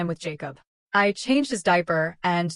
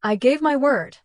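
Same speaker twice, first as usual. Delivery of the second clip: lightly grinning, higher-pitched reply; 6 semitones higher, 4 dB louder than usual. A narrator's reading. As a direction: say it through a slight grin. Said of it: "I said with a grin".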